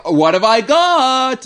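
high, drawn-out influencer squeal; annoying voice. Acted. Co-host mocking influencer unboxing videos, annoying voice. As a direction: stretch it out in an irritating high whine. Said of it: "Like that annoying voice".

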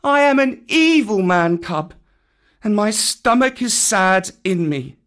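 put-on high-pitched, mournful child's voice; Mowgli, sorrowful. A narrator's reading. Mowgli confesses he is an evil man cub, sorrowful. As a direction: lament it high and plaintively. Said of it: "said Mowgli sorrowfully".

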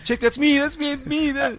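high, eager "that's me"; excited fan voice. Retold or imitated. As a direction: point at the screen thrilled. Host imitates young fan pointing.

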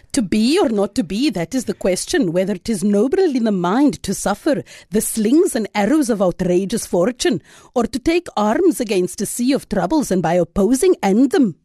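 low, declaimed Shakespeare; theatrical verse recitation, pitched low; soliloquy recital. Acted. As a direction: deliver a soliloquy in a dropped pitch. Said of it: "My soliloquy".

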